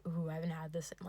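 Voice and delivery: whisper. Retold or imitated. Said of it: "and I'm just like".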